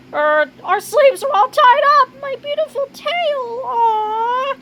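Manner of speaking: very high character voice in dismay; dismayed whine. Acted. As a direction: lament it in a high-pitched wail. Disguise torn, tail exposed.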